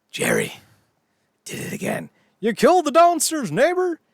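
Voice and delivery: incredulous sitcom outburst, jumping high and loud at the end; mock disbelief. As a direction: react like an appalled sitcom neighbor. Acted sitcom scene, appalled reaction.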